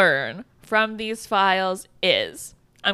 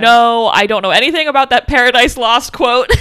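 loud mock denial, close to laughing; blanket refusal; 9 dB louder than usual. Imitating officials denying any knowledge of the quote, blanket refusal. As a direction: deny it emphatically, hamming up the official.

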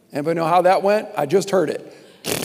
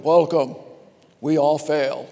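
ordinary talk

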